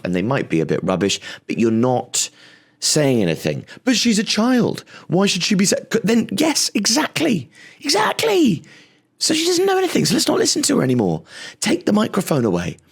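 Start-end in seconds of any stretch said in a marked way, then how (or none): 3.8-12.8 much higher, shrill mock outrage; mocking critic. Comic mocking the people who dismiss her in a high shrill voice. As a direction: ham up the indignant critic.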